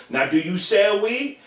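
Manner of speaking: slow squeaky interrogation; high slow mock question. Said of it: "Here we go".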